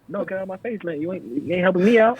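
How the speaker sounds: hammed-up annoyed clerk voice; mock fed-up clerk. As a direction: sneer it at a dumb customer. Mocking annoyed retail customer talk.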